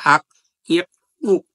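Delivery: আক, ইক, উক with the final ক bounced out hard; qalqala bounce. Shown as a demonstration. Teacher demonstrating the qalqala bounce on ক. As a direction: jolt the closing ক on আক, ইক, উক. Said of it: "ধাক্কা দেওয়ার চেষ্টা করব".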